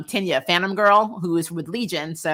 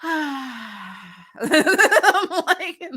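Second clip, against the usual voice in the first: sighing laugh; 9 semitones higher, 3 dB louder than usual. Real, not acted. Sigh and laugh, exasperated.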